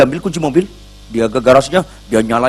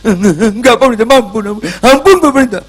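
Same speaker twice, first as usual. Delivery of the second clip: pleading; 11 semitones higher, 6 dB louder than usual. Retold or imitated. Husband begging pastor for mercy.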